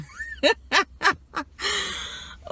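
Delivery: long, high peal of laughter; amused cackle. Real, not acted. Picturing queues of Pinocchio noses, a high cackle. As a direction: crack up at a silly picture.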